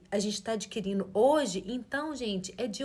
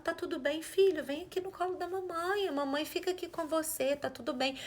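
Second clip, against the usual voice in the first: softer, tender comfort; soothing mother; 5 semitones higher, 4 dB quieter than usual. Retold or imitated. Mother soothing her crying child, soft and tender. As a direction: hush the crying child gently.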